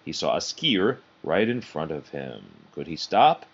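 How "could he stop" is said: ordinary delivery, nothing marked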